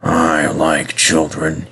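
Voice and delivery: low, very slow, tender confession; simple, sincere. A narrator's reading. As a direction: say it simply and slowly, from the heart. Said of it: "he said simply".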